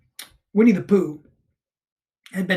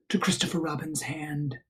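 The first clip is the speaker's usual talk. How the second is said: ordinary delivery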